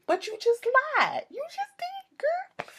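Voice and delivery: very high squealed call-out; tiny high-pitched mock accusation; mock squeaky protest. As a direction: squeal it in comic disbelief, thinning out. Mock reply to a liar's claim.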